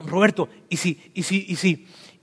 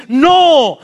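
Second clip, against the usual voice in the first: loud no; 8 semitones higher, 7 dB louder than usual. Shown as a demonstration. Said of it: "más fuerte".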